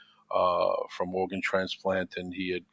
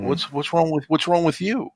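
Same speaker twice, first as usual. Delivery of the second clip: higher-pitched, rising disbelief; retold concerned question; 8 semitones higher, 3 dB louder than usual. Asking the friend in hospital what's wrong, concerned. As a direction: press the question, pitch climbing.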